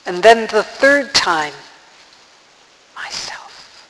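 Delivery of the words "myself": barely audible whispered "Myself"; very soft whisper. A narrator's reading. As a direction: whisper "Myself" so softly it's hardly heard. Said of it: "whispered so softly you could hardly hear it".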